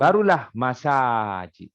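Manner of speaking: "masajid" slow, with a long "saa" in the middle; broken plural demonstrated. Shown as a demonstration. Teacher shows where the word breaks, "masajid" with long middle vowel. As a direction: open up "masajid" as ma-saa-jid. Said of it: "Baru dia pecah".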